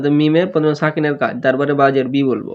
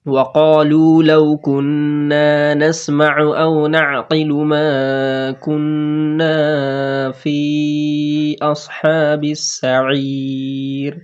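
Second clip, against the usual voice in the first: slow, chanted Arabic tilawat; Quran recitation; much slower than usual. Acted. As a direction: recite the verse in a measured chant. Recites the full verse. (ধীর সুরে কুরআন তিলাওয়াত)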